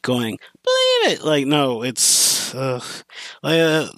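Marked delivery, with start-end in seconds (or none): 0.6-1.2 squeaky high cartoon catchphrase; mock the annoying dub line way up high; annoying Naruto catchphrase. Reviewer mocking the annoying dub catchphrase, very high. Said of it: "that annoying ass voice going".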